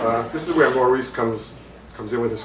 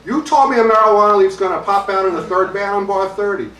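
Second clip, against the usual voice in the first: loud, high comic tirade; acted furious complaint at full voice; angry yelling; 9 semitones higher, 7 dB louder than usual. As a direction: rant like a cheated customer. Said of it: "he calls me up and he's yelling".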